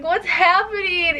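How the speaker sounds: high, stretched-out mock wail; retold bawling. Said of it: "I was bawling".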